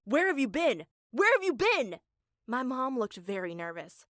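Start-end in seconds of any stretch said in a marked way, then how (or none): 0.1-0.9 mad dad yelling a question; first angry demand, already raised; angry shout. Retold or imitated. Furious dad demanding where his family went, shouting. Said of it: "he was so mad he screamed". 1.1-2.0 much higher and louder repeated yell; screaming. Retold or imitated. Dad screaming the question again at his family. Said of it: "he was so mad he screamed".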